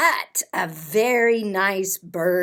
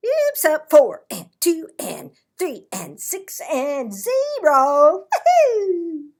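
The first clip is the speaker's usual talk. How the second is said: loud, higher goofy counting ending in a whoop; excited counting; 6 semitones higher, 9 dB louder than usual. Acted. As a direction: bungle the count and cheer. Tacky botches counting, cheers.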